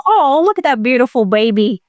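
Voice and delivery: lightly cooing, a touch tearful; mock getting teary over a baby; imitating mild teariness. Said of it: "not just a little tearful".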